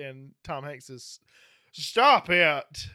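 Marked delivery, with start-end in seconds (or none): 1.7-2.9 a hint of a Dutch accent yelling; mock Dutch shout, laughing; Dutch guy voice. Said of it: "his cool Dutch guy voice".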